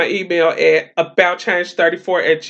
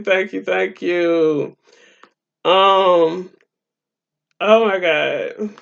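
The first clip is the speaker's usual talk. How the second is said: normal talking voice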